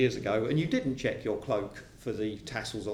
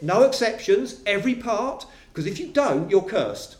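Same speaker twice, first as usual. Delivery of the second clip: higher, louder and pressing; insistent preaching; 7 semitones higher, 5 dB louder than usual. Acted. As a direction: drive the point home to the listener. Preacher voicing Paul, insistent.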